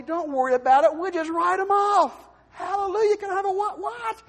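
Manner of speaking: loud, high, churchy exultation; overjoyed collector. Retold or imitated. Bill collector forgiving the debt, loud and high. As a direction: shout it like a revival cheer. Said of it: "collectors call and say".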